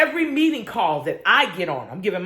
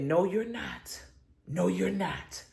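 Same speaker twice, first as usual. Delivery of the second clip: body says no; 2 semitones lower, 7 dB quieter, slower than usual. Retold or imitated. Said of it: "And my body said".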